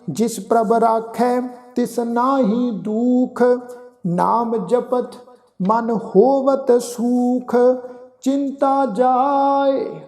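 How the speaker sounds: slow, measured chanting of the verse; scripture recitation. Acted. Teacher recites Gurbani slowly to the sangat. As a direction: recite each phrase slowly and devoutly.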